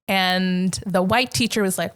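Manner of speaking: usual speaking voice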